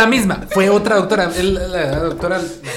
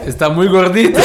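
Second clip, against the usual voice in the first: louder, laughing doctor quote; laughing mimic; 3 semitones higher, 8 dB louder than usual. Retelling the doctor's verdict to friends, laughing. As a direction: laugh while quoting the doctor.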